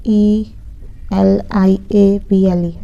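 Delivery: slow letter-by-letter spelling. Shown as a demonstration. Teacher slowly spells the word for students. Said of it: "यहाँ पे spelling wrong है".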